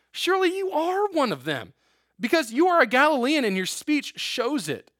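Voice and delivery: high, insistent accusation; accusing bystanders. Acted.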